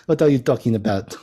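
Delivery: mean French ice queen in a mock accent; put-on French accent, icy brush-off; snooty French accent. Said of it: "I love the accent".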